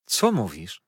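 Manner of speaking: taken aback, emphatic question; surprised exclamation. A narrator's reading. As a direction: exclaim in disbelief. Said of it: "zawołał szeroko".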